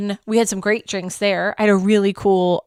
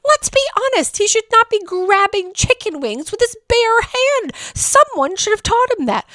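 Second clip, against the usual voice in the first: indignant; 11 semitones higher than usual. Retold or imitated. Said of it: "I was like".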